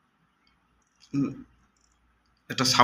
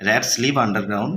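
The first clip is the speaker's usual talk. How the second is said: slow, deliberate sentence; slow reading aloud; much slower than usual. Shown as a demonstration. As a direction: read it slowly for the class. Teacher reading example. (ধীরে পড়া)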